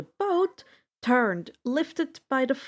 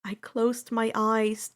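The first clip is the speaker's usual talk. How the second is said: ordinary delivery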